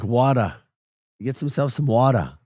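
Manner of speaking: playful New Yorker, dropped R's; mock New York "wawda"; New York accent. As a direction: imitate New York friends saying water. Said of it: "Love the New York accent".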